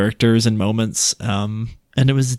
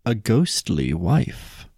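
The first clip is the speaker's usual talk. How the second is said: slow, softer, spooky title announcement; hushed, drawn-out announcement of a ghost tale; ominous title reading; 5 dB quieter, much slower than usual. Shown as a demonstration. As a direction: draw the title out like a ghost story opener.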